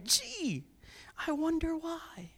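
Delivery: soft, high mock musing; sarcastic wonder. Preacher mocking the king's complaint, sarcastic wonder. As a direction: wonder aloud with a knowing wink.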